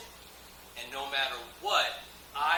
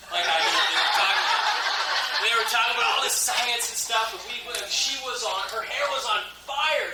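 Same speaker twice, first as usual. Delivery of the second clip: excited; 6 semitones higher, 6 dB louder than usual. Real, not acted. Excited retelling, laughing.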